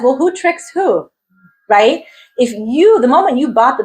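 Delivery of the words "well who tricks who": Leah retorting. Retold or imitated. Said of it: "And she says".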